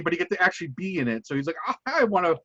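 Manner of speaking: normal talking voice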